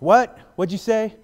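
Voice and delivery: high, loud challenging snap; angry wife. Retold or imitated. Wife confronting husband, loud high demand. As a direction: dare him to repeat it. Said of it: "his wife's like".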